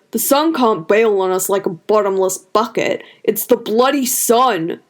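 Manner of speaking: lightly voiced farmer, dismissive; gruff villager Fardo. A narrator's reading. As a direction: wave off the worry, slightly rough.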